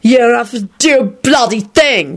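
put-on slow drunken snarl; slurred, angry. A narrator's reading. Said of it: "Victor slurred angrily".

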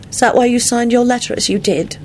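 high, musing woman's voice; musing question, pitched high. A narrator's reading. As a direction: wonder it aloud. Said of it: "she said musingly".

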